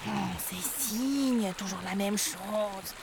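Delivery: weary duck sigh. A narrator's reading. Duck sighs at swan.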